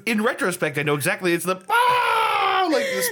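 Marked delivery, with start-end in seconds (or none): 1.7-3.1 sudden high shrieking yell; Pee Wee Herman shriek. Acted. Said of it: "You just frightened the hell out of me".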